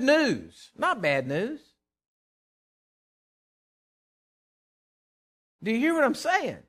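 high, loud preaching; fiery preaching. Acted. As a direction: drive the point home to the folks. Preaching at full force.

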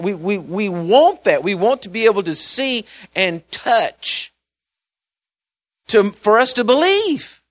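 high, loud, impassioned sermon build; climbing into a fervent preaching roar; full-force preaching. Acted. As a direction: preach it out, rising to a cry.

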